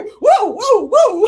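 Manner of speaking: loud, very high whoops; piercing mock hollers of excitement; high-voltage whooping. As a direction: imitate a hyped client whooping. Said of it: "she'd go".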